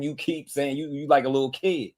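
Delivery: normal talking voice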